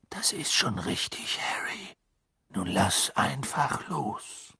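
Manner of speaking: soft, hushed reassurance; soothing. A narrator's reading. Dumbledore calming Harry so he lets go, soothing.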